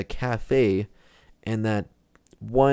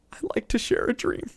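breathy whispered reply; 14 semitones higher, 5 dB quieter than usual. Acted. Gamer softly whispering his menu choice to the guide. Choosing the dialogue option.

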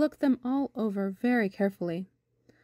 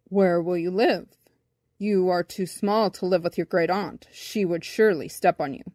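slightly lowered, grave advising voice; lightly voiced bumblebee; 4 semitones lower than usual. A narrator's reading. Bumblebee warns boy about great aunt, low and sober. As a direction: warn him soberly, pitched down.

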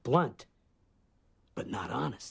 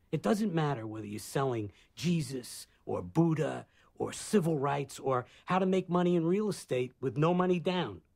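higher, building in force and pitch; impassioned rebuke; 4 semitones higher, 4 dB louder than usual. Acted. Man lectures Bob about preaching Jesus like lubricants, impassioned rebuke. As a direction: press the accusation home with rising intensity.

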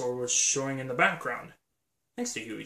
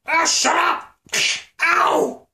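shout; 14 semitones higher, 10 dB louder, slower than usual. Acted. Shouting at companion.

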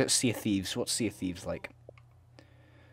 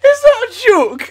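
outraged squeal; 15 dB louder than usual. Real, not acted. Outraged at chat.

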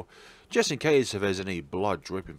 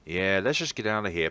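ordinary talk, nothing marked

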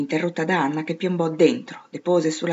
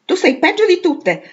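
put-on much higher, louder childish outburst; angry retort; 12 semitones higher, 6 dB louder than usual. A narrator's reading. Amy retorting to Jo in a quarrel, high and cross. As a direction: snap back in a high, indignant voice.

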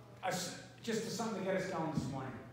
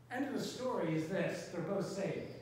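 usual speaking voice

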